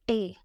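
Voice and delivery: "te" with a long German e; German letter T. Shown as a demonstration. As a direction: say "te" like "tay". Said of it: "it's pronounced as te".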